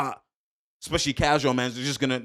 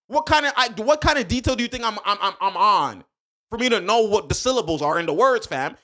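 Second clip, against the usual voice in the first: louder, higher heated ranting; passionate rant; 7 semitones higher, 3 dB louder than usual. Real, not acted. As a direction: hammer the point home, all fired up. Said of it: "Understand why I'm talking like this".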